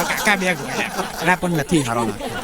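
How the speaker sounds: low, slow, lightly voiced buying roses; a hint of a sheepish groom asking for roses; mock groom voice. Mocking groom on pink scooter.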